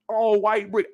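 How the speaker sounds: ordinary talk, nothing marked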